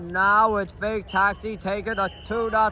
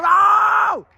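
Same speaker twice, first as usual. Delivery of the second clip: excited shout; 10 semitones higher, 6 dB louder than usual. Real, not acted. Commentator reacts to goal.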